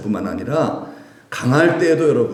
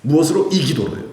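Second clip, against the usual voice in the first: usual speaking voice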